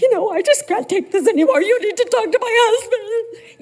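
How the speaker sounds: high, loud, fast pleading outburst; exasperated plea. Retold or imitated. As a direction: let it burst out, frantic and fed up. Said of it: "And I'm like".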